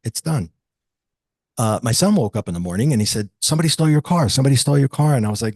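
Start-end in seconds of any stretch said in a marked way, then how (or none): 3.4-4.3 a son's mock alarm, pitched up; excited retold alarm. Son alarmed at missing car. 4.3-5.1 repeated mock alarm, a bit louder; retold alarm, pressing harder; alarmed son again. Son repeats alarm about car.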